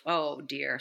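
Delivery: usual speaking voice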